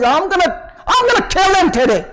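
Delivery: high-pitched, emphatic mock threat; acted angry man. Son acting like an angry man threatens father, mock furious. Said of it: "an angry man".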